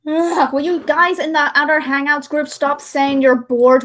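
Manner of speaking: ordinary delivery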